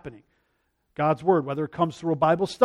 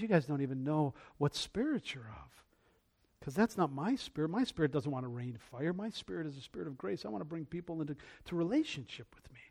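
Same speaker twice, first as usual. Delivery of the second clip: very soft, low voice of the Lord; gentle correction; 5 semitones lower, 18 dB quieter than usual. Retold or imitated. Jesus correcting fiery disciples, hushed and low. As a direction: hush it down and correct them tenderly.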